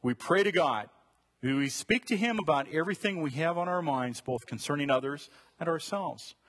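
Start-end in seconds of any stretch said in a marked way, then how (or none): none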